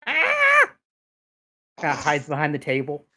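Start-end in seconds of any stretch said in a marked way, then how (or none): 0.1-0.7 high, loud kobold yelp; startled shriek. Acted. Kobold sees the party approach, startled shriek. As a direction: shriek in sudden fright. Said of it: "and you go".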